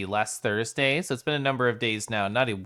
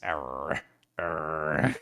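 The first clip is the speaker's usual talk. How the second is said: throaty R roll; 9 semitones lower, 6 dB quieter than usual. Shown as a demonstration. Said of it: "I just kinda reverberate my throat".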